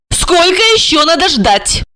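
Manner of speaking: high, menacing demand; sharp female ultimatum; threatening question. A narrator's reading. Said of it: "с угрозой спросила Регина".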